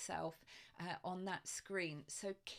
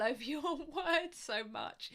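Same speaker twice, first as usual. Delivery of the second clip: amused, voice bubbling into laughter; laughing; 5 semitones higher, 5 dB louder than usual. Real, not acted. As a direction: laugh through the words. Laughs while mentioning stumbling over words.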